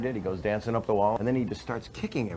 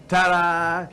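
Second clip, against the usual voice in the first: louder, higher little showbiz ta-da; family's cute finale, higher and louder; 9 semitones higher, 8 dB louder than usual. Acted. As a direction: present it sweetly. Family finishing their act.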